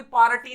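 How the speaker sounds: पार्टी as three-part "paa-ra-ti"; pronunciation nobody uses. Shown as a demonstration. Teacher mocking a spelling reading, पार्टी as "paarati". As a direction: sound the r of पार्टी as its own syllable. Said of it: "कोई पार्टी नहीं बोलता".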